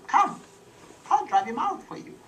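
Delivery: small frog offering to help; little frog. Acted. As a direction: pipe it up like a brave little frog.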